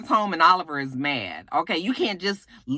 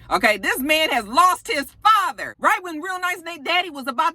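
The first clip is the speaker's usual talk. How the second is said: indignant shout; 10 semitones higher than usual. Real, not acted. Indignant about the show.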